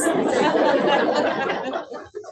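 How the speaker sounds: loud, high burst of real laughter; hearty laugh. Real, not acted. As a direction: let the laugh take over. Bursts out laughing at her defiance.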